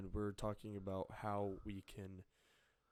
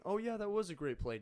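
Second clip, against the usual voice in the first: sincere-sounding; 9 semitones higher, 5 dB louder than usual. Shown as a demonstration. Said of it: "You're not saying".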